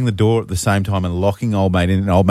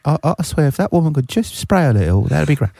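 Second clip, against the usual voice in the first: higher-pitched put-on English gossip; mock English accent; 8 semitones higher than usual. Wife complaining about Susan from Pilates, mock English accent. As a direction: grumble in a hammed-up English voice. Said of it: "butchering this accent".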